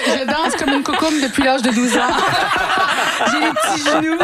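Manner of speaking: amused self-mocking laugh. Real, not acted. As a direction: giggle through the words. Laughing about her own dancing.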